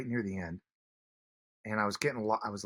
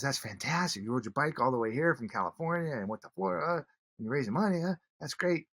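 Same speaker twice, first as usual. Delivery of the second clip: impressed stranger; 7 semitones higher than usual. Retold or imitated. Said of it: "She goes".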